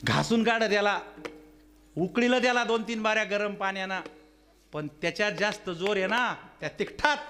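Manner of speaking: high-pitched, emphatic sermon delivery; preaching. Real, not acted. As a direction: drive the moral home to the gathering. Preaches moral to the gathering.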